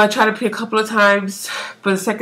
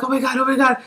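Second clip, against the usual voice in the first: mildly panicky, raised pitch; retold anxiety; 5 semitones higher, faster than usual. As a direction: imitate an anxious little panic. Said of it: "like, anxiety".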